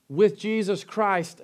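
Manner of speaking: usual speaking voice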